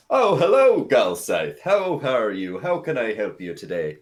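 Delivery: high, loud gushing welcome; effusive bartender. Acted. Bartender greets a regular, loud and effusive. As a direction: burst in big and high, then settle.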